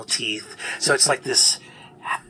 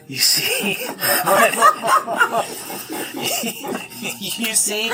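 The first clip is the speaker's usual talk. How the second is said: squeaky giggles around a high squeak; high-pitched cackling; 4 dB louder than usual. Real, not acted. Game master cracking up at her own line, high squeaky laughter.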